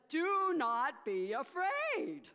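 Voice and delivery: slow, loud, high proclamation; a lightly voiced grand angel; angel's reassurance. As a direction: announce it like a heavenly messenger. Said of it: "and the angel said".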